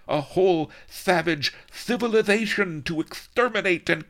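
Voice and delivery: high, loud mad gloating; high, loud mad viceroy gloat; gloating villain. Acted. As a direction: leer at her while boasting. Viceroy gloating to his consort.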